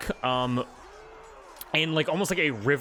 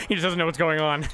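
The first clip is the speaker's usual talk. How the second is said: usual speaking voice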